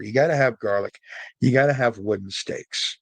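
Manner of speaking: ordinary talk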